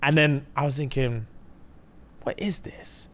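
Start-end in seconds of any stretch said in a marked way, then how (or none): 2.2-3.1 hushed, whispered disbelief; whispered thought. Retold or imitated. Thinking this drama is fake, whispered. Retelling own sceptical inner thought.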